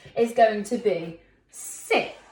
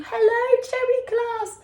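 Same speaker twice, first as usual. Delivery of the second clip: "Hello" much higher and louder, with a thrilled lift; excited alien; 13 semitones higher, 4 dB louder than usual. Acted. Alien excited to see the class, high and loud. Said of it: "He's so excited to see you".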